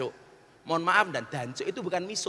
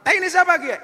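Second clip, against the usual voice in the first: sudden high, loud angry yell; enraged; 12 semitones higher, 10 dB louder than usual. Acted. Ngapak man confronting a Sundanese over the mess, enraged yell. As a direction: burst out in acted outrage. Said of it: "Maka orang ngapaknya ngamuk".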